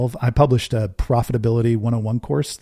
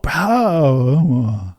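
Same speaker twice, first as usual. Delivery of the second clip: crowd roar; 3 semitones higher, 4 dB louder than usual. Retold or imitated. Imitating crowd cheer.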